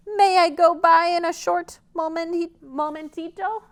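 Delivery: high little goat asking nicely; tiny polite goat. Acted. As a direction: ask sweetly like a small goat.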